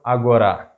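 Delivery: agora with the final RA stressed, a-go-RA; stress on last syllable. Shown as a demonstration. Teacher offering learners a last option, agora stressed on RA. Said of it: "How do you pronounce it?".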